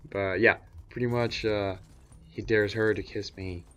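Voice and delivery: ordinary talk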